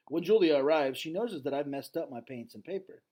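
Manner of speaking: story reading. A narrator's reading. Teacher reading the novel aloud to class, lively read-aloud. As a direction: read the chapter with energy to students.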